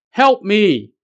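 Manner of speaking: high-pitched narrated cry for help; shouted plea. A narrator's reading. Unseen stranger calling to travelers on the mountain, shouted plea. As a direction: call out for rescue, moderately loud. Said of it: "he suddenly heard someone shouting".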